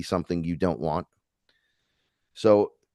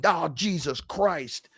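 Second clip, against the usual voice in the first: mock exclaim; 7 semitones higher, 4 dB quieter, slower than usual. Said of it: "but I hear you going".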